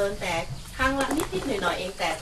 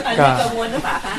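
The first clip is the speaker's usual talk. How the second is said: laughing; 12 dB louder than usual. Real, not acted. Laughing follow-up question.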